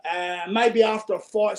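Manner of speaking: normal talking voice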